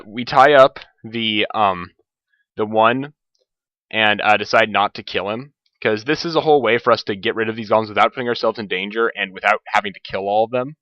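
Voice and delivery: ordinary talk, nothing marked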